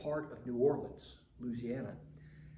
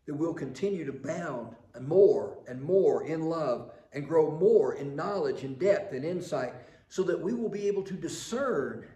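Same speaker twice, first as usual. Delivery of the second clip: louder, fervent prayer; preaching prayer; 3 semitones higher, 8 dB louder than usual. Acted. Preacher praying over the congregation, loud and fervent. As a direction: lift the prayer with full force.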